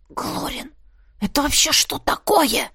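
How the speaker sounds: hushed, whispery croak on the name, then strained disbelief; hoarse, appalled character voice; wheezing. A narrator's reading. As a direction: wheeze out the question. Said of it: "просипел Хуан".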